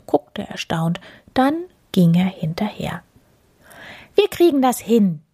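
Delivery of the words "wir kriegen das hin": high little hare voice. A narrator's reading. Hare offers to help the bear, high-pitched. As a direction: say it raised and bright-eyed as a hare. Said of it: "sagte der Hase".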